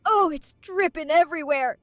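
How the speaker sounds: put-on loud disgusted cry in a hillbilly accent; grossed-out outcry. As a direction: yelp in revulsion, country style. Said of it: "start barfing everywhere".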